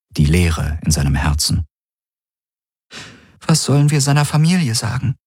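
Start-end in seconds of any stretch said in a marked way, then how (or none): 3.5-5.2 distressed woman. Acted. Grieving woman asks about family.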